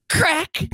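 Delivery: sudden high, sharp "crack"; imitated crack. Storyteller hearing the windshield go, imitated crack. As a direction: snap out the word as a breaking noise. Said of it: "And then I heard".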